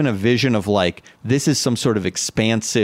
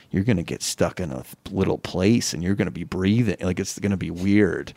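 lower, softer taunting inner voice; 7 semitones lower, 5 dB quieter than usual. Retold or imitated. The anxious inner voice, a low taunt. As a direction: sneer it low as your anxiety. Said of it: "that voice came up like".

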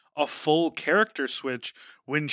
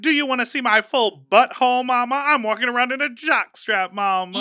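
much higher and louder, teasing and over the top; campy mock voice; 8 semitones higher, 7 dB louder than usual. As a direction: ham up a flamboyant guy teasing his friend. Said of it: "He's like".

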